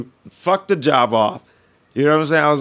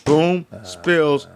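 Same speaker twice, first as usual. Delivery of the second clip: ordinary talk, nothing marked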